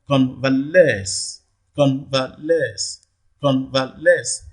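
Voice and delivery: convalesce very slowly, syllable by syllable, weight on LESCE; stress on last syllable. Shown as a demonstration. Teacher shows own pronunciation, slow convalesce stressed on LESCE. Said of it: "primary stress falls on the last syllable".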